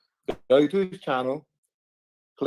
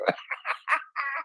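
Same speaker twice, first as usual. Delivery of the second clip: high, quiet, scornful laugh; a short derisive chuckle; mocking laugh; 10 semitones higher, 7 dB quieter than usual. Real, not acted. Laughs at his own jibe.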